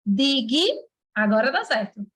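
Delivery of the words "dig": "Dig" held together as one separate chunk; syllable by syllable. Shown as a demonstration. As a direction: sound out "Dig" on its own, slowly for students. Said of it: "É assim que se separa essa palavra".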